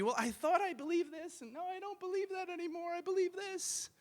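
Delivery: high, soft voice of someone second-guessing; small, high-pitched dithering, hammed-up; mock wavering believer. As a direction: mimic someone constantly switching beliefs, softly. Voicing a wavering believer's quote.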